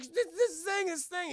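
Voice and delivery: high, stammering mock panic; flustered alarm. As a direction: stumble over the words as if frightened. Leia stammers in alarm, retold.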